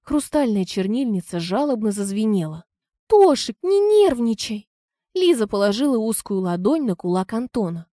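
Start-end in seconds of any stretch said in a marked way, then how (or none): 3.1-4.7 high, coaxing woman's voice; calm him down, sweetly and high; high soothing wife voice. Acted. Wife soothes angry husband. (высокий ласковый женский голос)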